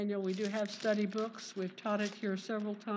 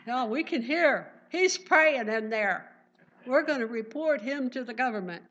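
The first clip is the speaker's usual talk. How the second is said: loud, eager voices reporting Daniel; the accusers, loud and eager, telling on Daniel; tattling accusers; 2 semitones higher, 7 dB louder than usual. Pretend. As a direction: call it out like snitches who caught him. Accusers voiced, overheard praying.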